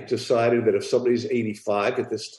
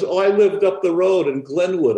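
put-on old woman voice; 8 semitones higher, 6 dB louder than usual. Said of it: "She goes".

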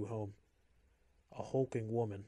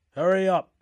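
barked order; 8 semitones higher, 15 dB louder than usual. A narrator's reading. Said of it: "one of the guards said".

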